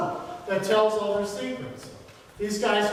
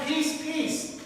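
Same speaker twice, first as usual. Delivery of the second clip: higher, soft Scotch-Irish lilt on "Peace, peace"; a hint of a Scotch-Irish farmer begging for peace; lilting Scotch-Irish plea; 6 semitones higher, 5 dB quieter than usual. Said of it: "with a lilting Scotch-Irish accent".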